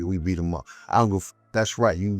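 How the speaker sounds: usual speaking voice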